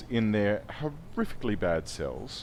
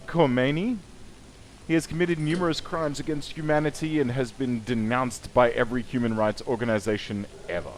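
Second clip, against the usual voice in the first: mock dramatic bio read; 4 semitones higher, 6 dB louder than usual. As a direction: narrate it like a hammed-up trailer. Reads prisoner bio with gusto.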